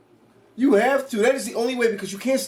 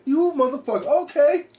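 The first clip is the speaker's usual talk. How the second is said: indignant Michael voice; 7 semitones higher than usual. Retold or imitated. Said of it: "Mike was like".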